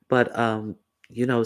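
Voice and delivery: ordinary talk, nothing marked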